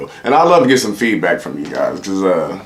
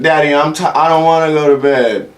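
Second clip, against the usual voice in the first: put-on whiny kid; 6 semitones higher than usual. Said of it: "Let my kids say some shit".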